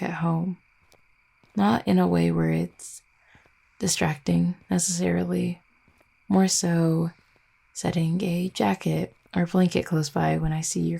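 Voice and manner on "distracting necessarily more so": ordinary delivery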